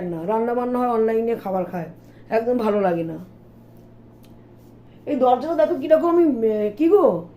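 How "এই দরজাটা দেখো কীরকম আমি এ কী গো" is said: calling out. Real, not acted. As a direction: call across the house, higher and louder. Calling to husband about door.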